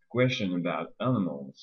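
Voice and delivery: "Question about animals" stressed on QUESH, BOUT and A; three stressed syllables. Shown as a demonstration. Teacher giving an English example, stressed syllables standing out in "Question about animals". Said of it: "On a donc trois syllabes accentuées".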